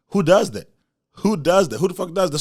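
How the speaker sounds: loud, high outrage; outraged. Real, not acted. Ranting about dad's hypocrisy, loud outrage. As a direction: shout it in disbelief. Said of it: "outrage at dad".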